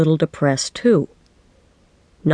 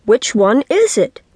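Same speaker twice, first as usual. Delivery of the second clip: higher, insistent question with mild shout; exasperated shout; 7 semitones higher than usual. A narrator's reading. Child finally demands an answer from dad, shouting. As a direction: raise it high on "is". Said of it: "I finally shouted".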